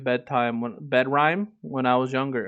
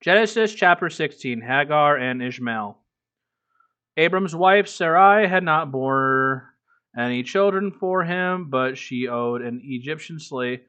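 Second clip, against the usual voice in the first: a lightly heightened Bible reading; scripture reading; 4 dB louder than usual. Acted. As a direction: read the passage aloud like a lector, a bit above your chat voice. Reads scripture aloud to chat.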